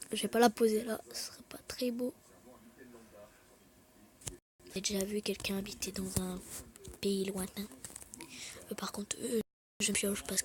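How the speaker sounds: hushed low murmur while playing; whispered commentary. Real, not acted. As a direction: talk under your breath. Whispered gameplay narration. (commentaire chuchoté)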